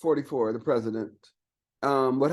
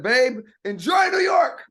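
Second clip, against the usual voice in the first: hammed-up farewell; 7 semitones higher, 5 dB louder, slower than usual. Ditching wife for golf with president.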